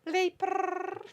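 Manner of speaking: high, trilled French cat purr; mock French purr. Co-host imitating a French-speaking cat, mock French purr. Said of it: "he meows in French".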